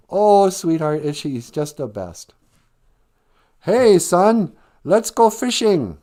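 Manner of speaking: mock a too-perfect TV family; sitcom family impersonation. Bender mocking the nerd's family, hammed-up sweet. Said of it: "This is my impersonation of life at Brian's house".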